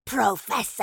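raspy wraith call, drawn out; rasping creature. A narrator's reading. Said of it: "rasped a voice from within the cascade".

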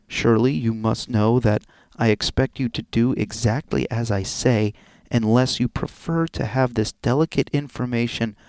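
low, loud, deliberate read; blackmail letter reading. Acted. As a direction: read the threat out in measured, emphatic tones.

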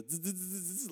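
soft, stuttering buzz; shaky zipper noise. Retold or imitated. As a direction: imitate a zipper in trembling hands. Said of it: "he was shaking".

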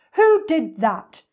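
loud, booming indignation; forceful elephant scolding; big-voiced elephant. A narrator's reading. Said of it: "in his big, deep voice".

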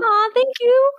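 squealed thank you, high and bright; high touched aw. Real, not acted. Touched by fan review.

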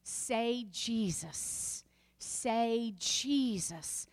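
very slow, drawn-out command; slow urging. Retold or imitated. Praying over a seizing girl, very slow and steady. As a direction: stretch each word out slowly. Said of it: "I said".

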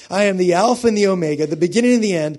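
preacher raising the church to a shout; full-force preaching. Acted. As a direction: build to a soaring sermon climax.

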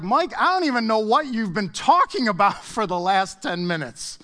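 high, loud mock protest; imagined baffled congregant. Congregant complaining to Mike about the theology talk, hammed-up bafflement. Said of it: "Maybe you're like".

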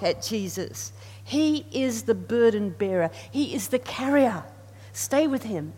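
ordinary talk, nothing marked

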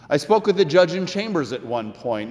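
ordinary talk

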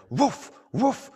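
two loud, high mock barks; high-pitched imitation barking; imitated dog barking. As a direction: woof loudly like a yapping dog. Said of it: "really barking at me like a dog".

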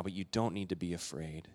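almost whisper the comfort; hushed comfort. Retold or imitated. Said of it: "Jesus is saying".

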